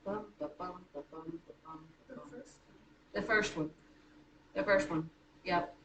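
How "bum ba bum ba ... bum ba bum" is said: soft, low "Bum, ba-bum" beats with the stress on each second "bum"; iambic da-DUM rhythm. Shown as a demonstration. Teacher to class, showing iambic pentameter with a quiet chant. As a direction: tap out the iambic beat under the breath. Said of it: "a line that is iambic pentameter".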